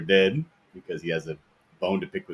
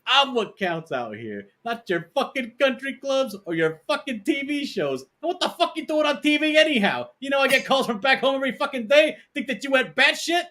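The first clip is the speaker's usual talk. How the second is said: much higher, hammed-up squealing mobster; Joe Pesci rant. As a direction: shriek it like a furious little gangster. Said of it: "He says".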